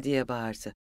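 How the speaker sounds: plain reading voice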